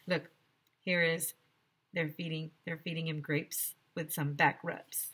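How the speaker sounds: plain reading voice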